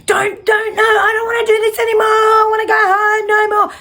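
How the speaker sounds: high, fast, sing-song whine of a woman in labour; hammed-up high whimpering plea; mock wailing protest. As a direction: wail it like a panicked mother in labour. Mock whiny childbirth protest.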